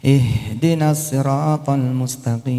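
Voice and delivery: Quran recitation. Acted. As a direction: draw each verse out like a qari. Al-Fatihah recited over music.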